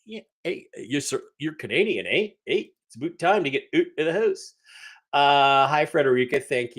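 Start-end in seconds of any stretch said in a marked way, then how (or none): 0.1-4.5 hammed-up Canadian accent with swooping pitch. Streamer mimicking how Toronto people talk, exaggerated Canadian accent. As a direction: imitate a Toronto local, Canadian "eh" and all. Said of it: "People really talk like that".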